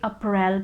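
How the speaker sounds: April said as "uh-PRELL", stressed on the end; wrong April. Shown as a demonstration. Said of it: "that's really wrong".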